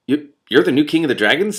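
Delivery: can hardly believe it; stunned disbelief; astonishment. A narrator's reading. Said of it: "Simran said in astonishment".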